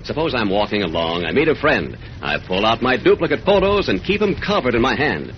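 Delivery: ordinary delivery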